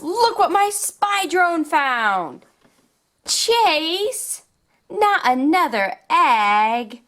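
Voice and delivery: put-on upbeat, sing-song storytelling for little kids; toy play narration. Showing toys to young viewers, upbeat and sing-song. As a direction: play along like a kids' toy show host.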